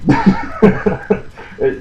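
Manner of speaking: airy unvoiced laugh; breathy laugh. Real, not acted.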